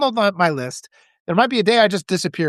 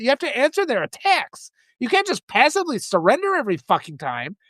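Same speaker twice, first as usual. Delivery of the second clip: ordinary delivery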